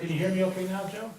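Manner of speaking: speaking off mic, faint and distant; turned away from mic. Real, not acted. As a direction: voice not reaching the microphone. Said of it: "Just talk into the mic".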